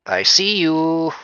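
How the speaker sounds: slow, high, eerie taunt; creepy singsong. Pretend. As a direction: draw it out like a creepy ghost. Teasing a spooky figure.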